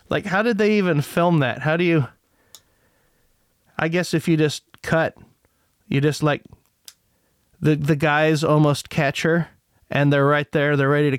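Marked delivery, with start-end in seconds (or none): none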